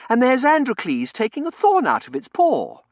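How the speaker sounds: high, loud, huffy insistence; affronted miss, voice right up; indignant explanation. Acted. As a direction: point out the obvious in outrage. Outraged Tossie continues correcting him.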